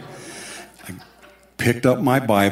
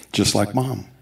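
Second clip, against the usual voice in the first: usual speaking voice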